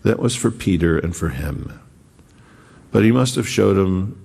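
ordinary talk, nothing marked

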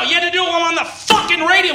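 ordinary delivery

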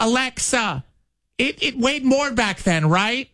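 high mock mom voice; imitating mom. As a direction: do his confused mom, pitched high. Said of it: "she gets so angry".

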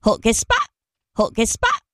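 garbled reversed syllables; backwards speech. Shown as a demonstration. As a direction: mimic a reversed recording. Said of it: "here she is in reverse".

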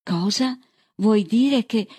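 high-pitched, incredulous outburst; exasperated disbelief. A narrator's reading. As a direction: pitch it up, indignant. Bird exasperated in acted quarrel.

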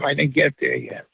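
normal talking voice

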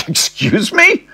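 nearly chokes on his drink; shocked disbelief. Acted. Ross told to chat up his dad's fiancée, shocked squeak. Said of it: "Pretty much spilling a mouthful".

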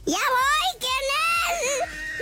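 high call to whoever knocks; llamado actuado. Acted. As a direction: sing it out high, as if answering the door. Contesta a gritos a la puerta. (grito agudo de quien va a abrir)

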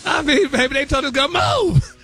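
high, laughing through the words. Real, not acted. Host laughing to co-hosts over [name] gossip. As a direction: crack up while telling it.

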